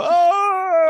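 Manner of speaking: very high, loud "Oh" yelp; high exclamation. Retold or imitated. Cohost imitating his reaction to dropped things, a loud high yelp. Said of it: "you go".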